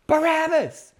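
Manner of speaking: high, loud yell imitating a mob; full-voiced retold shout; crowd yell. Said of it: "And the crowd yells".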